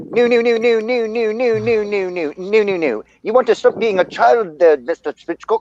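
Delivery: high, wobbly attempt at a New Zealand accent. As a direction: try a Kiwi accent that drifts British. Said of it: "Your accent's all over the place".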